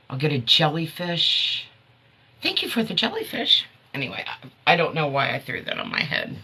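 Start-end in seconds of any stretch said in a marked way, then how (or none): 2.4-3.7 playful thanks. Real, not acted.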